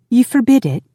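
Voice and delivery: high, loud, indignant echo; indignant, pitched well up and falling; outraged. A narrator's reading. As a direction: throw the order back in disbelief. Outraged wife echoes his order.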